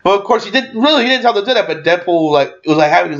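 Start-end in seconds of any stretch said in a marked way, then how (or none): none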